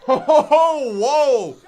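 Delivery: high, loud stunned whoa; excited whoa. Real, not acted. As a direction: let out a loud astonished whoa. Reacting to upset win.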